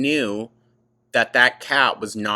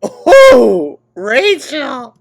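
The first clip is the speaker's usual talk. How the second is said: loud, high mock-scandalized Oh, Rachel; teasing her co-host for the swear; exclamation; 13 semitones higher, 15 dB louder, much slower than usual. Real, not acted. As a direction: cry out her name in playful shock.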